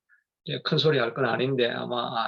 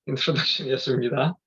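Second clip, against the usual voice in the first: laughing. Real, not acted. Laughs at long intro.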